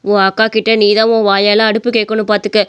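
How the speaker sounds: low-pitched reproachful elder; mother-in-law, insistent. Acted. (தாழ்ந்த குரலில் வற்புறுத்தும் மாமியார்)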